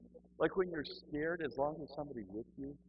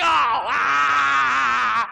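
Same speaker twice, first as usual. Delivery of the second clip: high, very loud sustained comic scream; long scream; 11 semitones higher, 19 dB louder than usual. Retold or imitated. Man shaken like a rag doll, long mock scream. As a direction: scream until breath runs out.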